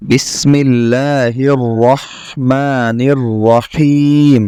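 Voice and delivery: slow, drawn-out recitation; Quran recitation. Shown as a demonstration. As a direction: recite with long held syllables for learners. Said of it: "আয়াত তিলাওয়াত করার চেষ্টা". (ধীরে টেনে তিলাওয়াত)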